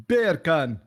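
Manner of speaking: loose attempt at a Jamaican accent. Shown as a demonstration. Showing friends that beer can sounds like bacon, Jamaican accent. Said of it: "beer can in a Jamaican accent".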